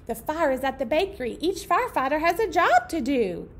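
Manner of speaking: lively picture-book read-aloud; reading to children. A narrator's reading. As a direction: read it out brightly for the little ones.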